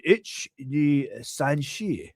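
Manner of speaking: slow, drawn-out mock-Japanese accent; amateur Japanese counting, each word stretched. Shown as a demonstration. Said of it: "counting to one to ten in Japanese".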